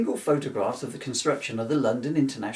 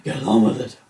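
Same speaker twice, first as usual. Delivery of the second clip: impatient heckle; 3 semitones lower, 8 dB louder than usual. Pretend. Heckling himself to hurry.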